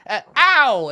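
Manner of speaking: a high, loud hammed-up ow; mock ow. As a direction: play the feeble fake injury. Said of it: "you're like".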